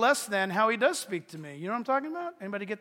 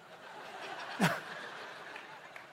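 breathy silent laugh; 8 dB quieter than usual. Real, not acted. Laughing at own idea.

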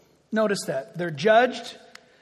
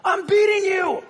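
high, louder cranky prophet voice; annoyed prophet; 10 semitones higher, 6 dB louder than usual. Acted. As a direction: snap back at the donkey, put-upon. Balaam answers the talking donkey.

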